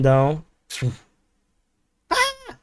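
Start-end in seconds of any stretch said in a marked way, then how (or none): 2.1-2.6 sudden high yelp; startled yelp. Real, not acted. Streamer startled by the Discord screen. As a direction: jump at a sudden fright.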